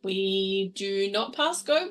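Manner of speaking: ordinary delivery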